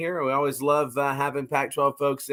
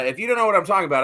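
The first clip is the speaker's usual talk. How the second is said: ordinary talk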